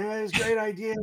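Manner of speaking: high-pitched mock groggy mumbling; mumbled gibberish. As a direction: run the words together in an excited, high mumble. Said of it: "mumbling gibberish".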